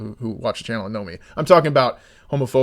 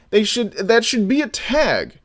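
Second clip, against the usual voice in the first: ordinary talk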